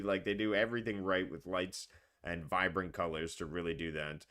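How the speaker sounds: ordinary talk, nothing marked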